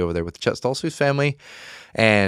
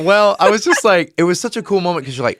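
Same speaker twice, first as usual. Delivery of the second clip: excited retelling; 9 semitones higher, 5 dB louder than usual. Retold or imitated. Reliving the big kick.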